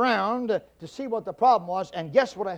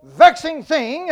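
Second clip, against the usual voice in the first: louder, higher, slow furious outburst; angry rant; 6 semitones higher, 8 dB louder, slower than usual. Retold or imitated. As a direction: let the husband blow up at the stereo. Angry husband rants at wife.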